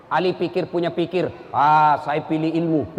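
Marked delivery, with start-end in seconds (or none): 1.5-3.0 low, slow, considered answer; pondering Ali. Acted. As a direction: draw out the "Ah" as if deciding. Ali answers after thinking.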